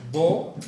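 loud, punched bo; labial B. Shown as a demonstration. Teacher illustrating the labial letter, loud emphatic bo. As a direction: burst bo off both closed lips.

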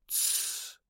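soft drawn-out "shh"; shushing. A narrator's reading. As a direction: hush the room quietly. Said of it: "приложила палец к губам". (тихое протяжное «тссс»)

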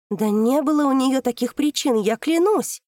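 high, loud desperate swearing; loud vow. Acted. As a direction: swear it at the top of the voice. Daughter swears in outburst.

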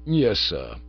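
low, smooth valet voice; Jeeves the butler. A narrator's reading. Butler Jeeves informing Bertie of a note, low and deferential.

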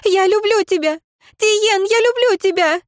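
high, loud desperate declaration; desperate cry. A narrator's reading. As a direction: cry out love in despair. Leira cries after him.

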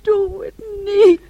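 loud, high, slow sobbing plea; tearful begging. Acted. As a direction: beg him through tears. Daughter begs in tears. (luid en huilend smekend)

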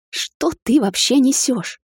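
high, slow, stunned question; incredulous outburst. A narrator's reading. Woman not believing the captain's accusation, incredulous. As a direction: blurt it out in disbelief. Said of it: "не веря собственным ушам, выпалила я".

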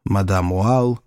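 usual speaking voice

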